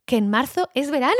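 put-on loud, high childish astonishment; amazed. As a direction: disbelief at the seasons. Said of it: "para mí fue como". (asombro infantil, más alto y fuerte)

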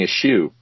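"eschew" said as eh-SHOO; incorrect pronunciation. Retold or imitated. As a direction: say "eschew" like "a shoe". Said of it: "said the word incorrectly, actually".

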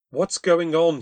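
loud, high desperate plea; frightened girl pleading loudly. A narrator's reading. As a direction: beg for an answer, loud and high. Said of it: "she pleaded".

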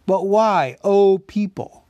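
high, loud oratorical plea; impassioned appeal. A narrator's reading. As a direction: call out to the whole crowd. Master appeals to the crowd.

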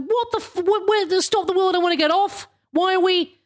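high, incredulous outburst; exasperated disbelief. Retold or imitated. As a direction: act stunned and fed up at once. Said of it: "I'm going".

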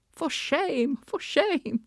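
high, hushed mock outrage; hammed-up scandalised reproach; mock shaming. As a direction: tut in pretend disgrace, high-pitched. Mock shaming herself for being northern.

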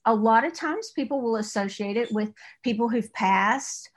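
normal talking voice

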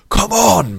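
high, very loud impatient shout; urgent roared order; bellow. A narrator's reading. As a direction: bellow it through the whole compartment. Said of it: "Vor bellowed in the compartment".

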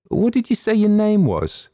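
a hint of a fragile young woman opening up; Molly, tearful young woman. A narrator's reading. Molly asks, voiced high.

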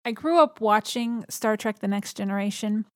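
ordinary talk

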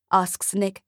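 usual speaking voice

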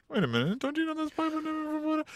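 hushed mock confusion. Viewers puzzled by the wrestler's introduction, hushed mock confusion. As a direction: drop the volume for an imagined puzzled reaction.

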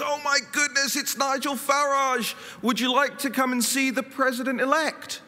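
high, bright mock gush of delight; gushing Kellyanne. Retelling Kellyanne spotting Nigel, high fawning gush. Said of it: "and she goes".

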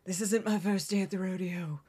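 mock warning in a put-on voice; tough-talker quote. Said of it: "Kind of like your".

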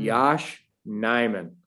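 "Yosh Nijman" said slowly with a Y sound, J silent; careful name pronunciation. Shown as a demonstration. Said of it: "The J is silent".

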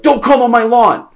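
high, loud grumpy giant shout; mock selfish giant yelling from his lawn. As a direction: bark him off the lawn like a cranky giant. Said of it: "like, the selfish giant".

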